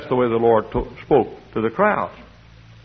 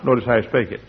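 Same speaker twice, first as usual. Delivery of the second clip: ordinary talk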